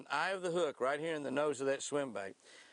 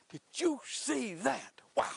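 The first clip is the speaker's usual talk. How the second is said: amazed; 9 semitones higher, slower than usual. Real, not acted. Amazed at the catch.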